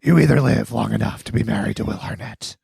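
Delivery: growled superhero parody, slowing at the end; mock Batman voice. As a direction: deliver it as a grave masked vigilante. Parodies Batman film line in voice.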